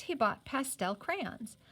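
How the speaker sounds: plain reading voice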